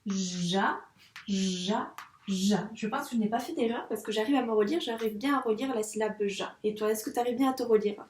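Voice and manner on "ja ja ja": ja read back syllable by syllable; reading back slowly. Shown as a demonstration. Teacher rereading pupil's syllables, slowly. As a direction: check each ja aloud, unhurried. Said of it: "Donc, je me relis".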